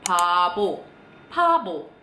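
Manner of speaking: "babo" begun nearly as "pabo"; P-like first B. Shown as a demonstration. Teacher modelling a drama word, P-like opening. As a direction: puff the first sound of "babo" towards P. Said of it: "dia tuh P-nya lebih kedengeran".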